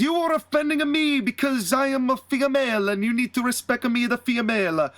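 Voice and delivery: high-pitched mock-Italian wrestler, offended; Santina Marella. Santina demands respect from Arn, high mock-Italian voice. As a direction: put on a high cartoon Italian accent. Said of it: "He says".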